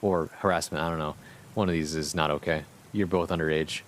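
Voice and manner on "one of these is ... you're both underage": plain reading voice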